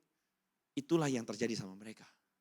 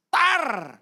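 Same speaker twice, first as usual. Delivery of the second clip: louder, higher "tar" for the strike; imitated cane whack; 11 semitones higher, 13 dB louder than usual. Mother caning the son, loud whack sound. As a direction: crack it out like the cane.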